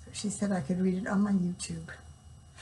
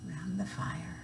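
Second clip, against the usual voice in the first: whisper; 2 semitones lower, 8 dB quieter than usual. Acted. Hushed aside in story.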